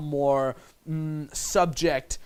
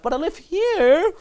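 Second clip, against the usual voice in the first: put-on girl's slower qualifying tone; 14 semitones higher, 7 dB louder, slower than usual. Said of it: "She's qualifying herself".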